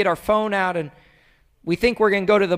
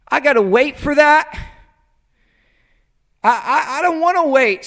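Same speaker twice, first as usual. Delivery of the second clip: higher, grumbling reluctance; mock complaint; 5 semitones higher, 3 dB louder than usual. Preacher mimicking us griping at God, reluctant complaint. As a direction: complain like you hate waiting.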